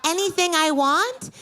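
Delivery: put-on high, sweet little-girl voice; mock kid voice. Comedian imitating a Make-A-Wish kid. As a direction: play the wishing child.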